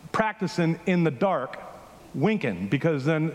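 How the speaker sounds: ordinary talk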